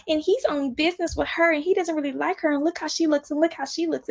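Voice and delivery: high, catty mocking voice; mocking impression. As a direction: parody her gossip. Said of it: "But you talking about".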